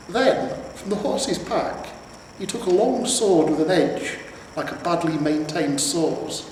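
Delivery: usual speaking voice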